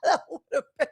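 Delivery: high laughing voice. Real, not acted. Listener cracking up at the story, high laughter. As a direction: laugh through the words.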